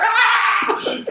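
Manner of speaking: high, loud startled "Ah!"; startled yelp. Retold or imitated. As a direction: jump at the sudden noise. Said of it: "I'm like".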